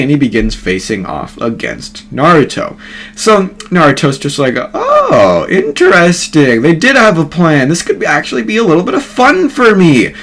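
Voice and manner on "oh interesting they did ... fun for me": gleeful intrigue. Acted. Naruto sizes up the Ten Tails, gleefully intrigued. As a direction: savour it high, like a toy just found. Naruto relishes the enemy's plan.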